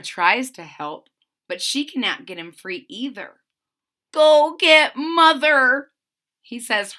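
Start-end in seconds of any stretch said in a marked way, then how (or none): 4.1-5.9 high, loud, slow scared cry; quivering plea. A narrator's reading. Stuck kitten Herbie begging his sister, quivering. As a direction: beg for help with a quiver. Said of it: "with a little quiver in his voice".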